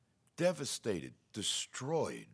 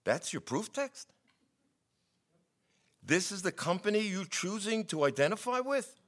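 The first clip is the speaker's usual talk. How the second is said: incredulous; 10 semitones higher, 4 dB louder than usual. Acted. Preacher challenging his friend's proof text, incredulous. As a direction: question it in rising, exaggerated disbelief.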